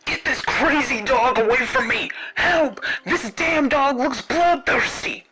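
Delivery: Bruce's terrified, high yelling voice; panicked scream. Acted. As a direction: plead frantically to be saved from a dog. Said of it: "Bruce started to scream and yell out".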